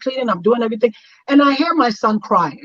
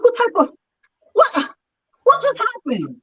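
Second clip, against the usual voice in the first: much higher, baffled cry of disbelief; stunned disbelief; 12 semitones higher than usual. Retold or imitated. Wife retelling the mother-in-law shutting the door, high baffled disbelief. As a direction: go way up in pitch, dumbfounded. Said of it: "I was like".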